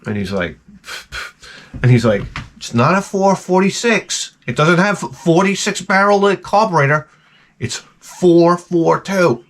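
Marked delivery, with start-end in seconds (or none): 2.6-9.4 higher, heated car-nerd insisting; indignant correction. Retold or imitated. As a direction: correct him like he insulted your car. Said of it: "he goes off the deep end".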